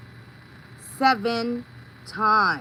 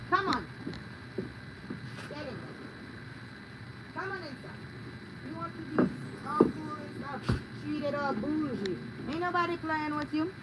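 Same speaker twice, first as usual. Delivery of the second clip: softer, higher pet talk; soft coaxing; 3 semitones higher, 11 dB quieter than usual. Real, not acted. She coaxes her pet inside, soft teasing pet talk. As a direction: murmur to the pet to come in.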